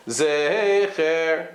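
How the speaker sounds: "Zecher" with a long held close eh; Vilna Gaon tserei. Shown as a demonstration. Teacher imitating the Vilna Gaon, stretched close eh. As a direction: draw out "Zecher" as zeh-eh-cher. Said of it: "something like that".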